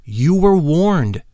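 slow solemn lady adept; solemn warning. Acted. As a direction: weigh each word like a decree.

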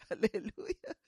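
ordinary talk

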